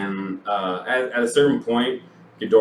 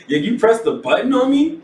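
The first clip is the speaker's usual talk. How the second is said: mock outrage; 7 dB louder than usual.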